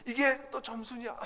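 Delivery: high, slow, drawn-out disbelief; mock the mother gasping at a bad score; shocked mother. Mother stunned by her son's report card, high and slow. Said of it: "깜짝 놀래요".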